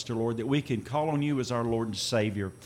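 normal talking voice

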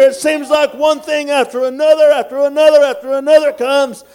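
rally them, rising and loud; full-force preaching. Real, not acted.

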